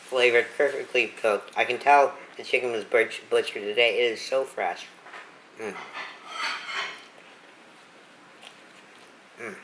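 chewing between words; muffled mumbling while eating; mouth full chewing. Real, not acted. Seen 0: Closed, pursed lips and moving jaw while holding taco throughout.

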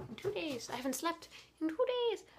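high, rising whine; overtired, exasperated whine; exhausted complaint. Real, not acted. Complaining of no food or sleep.

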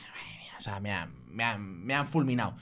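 breathless horrified whisper, stumbling; panicked whisper. Retold or imitated. Driver whispering in panic as the policeman returns. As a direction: whisper it as if your fate is sealed. Panicked thought after being stopped.

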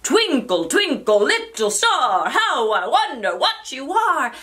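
loud, high, swooping recital; character voice combination. Shown as a demonstration. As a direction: swing the pitch up and down on each word. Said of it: "Try out different combinations".

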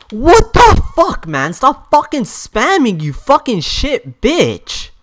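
high, loud tirade easing slightly; furious shouting. Real, not acted. Player demanding the opponent stop spamming, furious shouting. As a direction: yell abuse at the screen.

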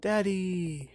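high, drawn-out cry for a parent; child calling out. Acted. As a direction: call out like a child to a father. Child in game cries out.

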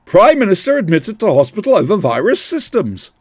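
a loud headline read; breaking news voice. Pretend. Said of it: "my breaking news voice".

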